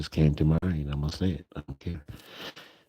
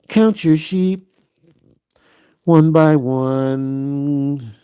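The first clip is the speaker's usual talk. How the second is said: slow, lightly raised lullaby chant; bedtime lullaby; 6 semitones higher, 5 dB louder, much slower than usual. Pretend. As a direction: croon it like tucking someone in.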